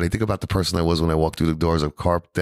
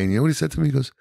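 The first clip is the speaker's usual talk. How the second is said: usual speaking voice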